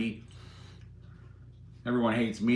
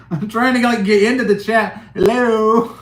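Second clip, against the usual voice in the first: much higher and louder, calling for attention; 8 semitones higher, 9 dB louder than usual. Real, not acted. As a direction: shout into the chat to be noticed. Calling out to ignored chat.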